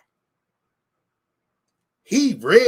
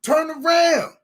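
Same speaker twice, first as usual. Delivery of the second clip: sharp raised call, higher and louder; 8 semitones higher, 4 dB louder, slower than usual. Retold or imitated. Telling his friend to turn and look, raised call. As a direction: snap the order at your friend. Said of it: "I tell my dude".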